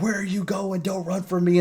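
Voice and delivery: mock desperate pursuit cry; pursuer calling out. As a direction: call after a fleeing enemy in a high voice. Acted chase after a fleeing demon.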